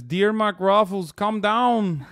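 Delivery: mock a politician in a thick Brazilian accent; Bolsonaro's Brazilian accent. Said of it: "I'm doing a very specific Brazilian accent".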